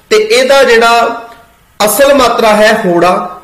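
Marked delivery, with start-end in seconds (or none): none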